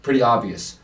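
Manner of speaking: ordinary delivery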